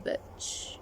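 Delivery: whispered shh. Real, not acted. Telling viewers it's a secret, whispered shh. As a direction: hush it like a secret. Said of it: "don't tell anybody".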